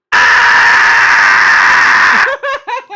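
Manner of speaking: very loud, long roaring scream; long wrestler scream. Acted. Wrestler alone with the microphone, screaming at full force. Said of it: "and he just goes".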